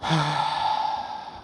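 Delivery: long weary sigh at the report card; mom's silent disappointed sigh. Retold or imitated. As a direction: let out a heavy let-down breath. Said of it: "she would just stare at it and go".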